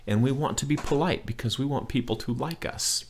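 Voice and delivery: ordinary delivery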